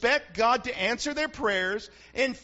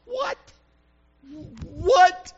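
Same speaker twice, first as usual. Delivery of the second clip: mock indignant disbelief, high; a hammed-up startled what; baffled what; 7 semitones higher, 3 dB louder than usual. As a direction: play the outraged innocent. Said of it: "and they're going".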